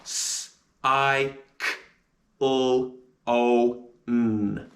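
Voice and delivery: s, I, k, l, o, n one by one; sounding out cyclone. Shown as a demonstration. Teacher sounding out the challenge word cyclone. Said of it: "And the sounds of cyclone are".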